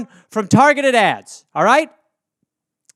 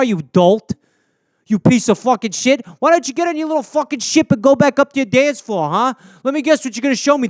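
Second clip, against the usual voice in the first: Jersey tough guy; 3 semitones higher than usual. Acted. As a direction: snarl threats at the alien. Jersey dude threatens alien.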